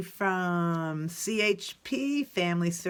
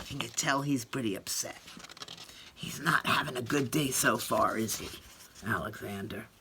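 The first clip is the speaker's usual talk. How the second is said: soft aside; 10 semitones lower, 8 dB quieter than usual. Acted. Aside to viewers.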